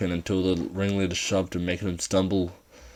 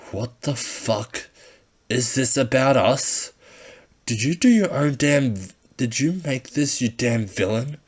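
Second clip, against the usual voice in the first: slow start building to loud, higher; angry accusation; 6 semitones higher, 4 dB louder than usual. Acted. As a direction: build from shock to fury. Ringleader reading the notebook.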